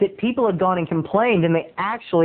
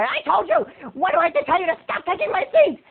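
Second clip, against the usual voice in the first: high-pitched squawky mock outburst; angry moviegoer; 11 semitones higher than usual. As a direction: act an irate woman in a high voice. Acts angry woman turning round.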